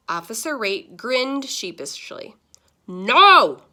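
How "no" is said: loud, sharp angry No; angry shout. Acted. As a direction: bark it at the officer. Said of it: "shouted Grandpa angrily".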